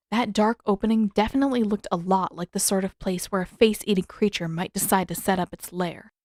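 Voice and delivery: plain reading voice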